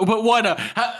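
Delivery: high, loud baffled whine in imitation; mock protest. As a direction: imitate a thwarted underling demanding reasons. Imitates baffled subordinate protesting the order.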